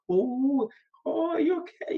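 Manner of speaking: very high, drawn-up "oh" of recognition; surprised greeting. Retold or imitated. Catching up with a colleague over coffee, high surprised greeting. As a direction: act out a friendly check-in, pitch leaping up.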